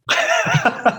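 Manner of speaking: short laugh that jumps high. Real, not acted. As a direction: let a high giggle slip out. Laughs after self-deprecating remark.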